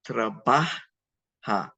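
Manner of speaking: trabaja drawn out, last syllable set apart; stem, then ending. Shown as a demonstration. Teacher putting an A ending on, slowly in parts. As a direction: pause before the final "ja" of trabaja. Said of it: "You put an A ending on".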